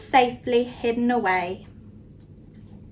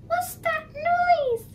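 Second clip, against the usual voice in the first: very high, tiny squeak of a mouse; squeaky mouse; slower than usual. A narrator's reading. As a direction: squeak it like a scared little mouse. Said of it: "he squeaked".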